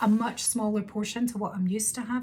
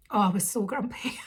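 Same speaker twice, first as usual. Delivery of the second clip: ordinary talk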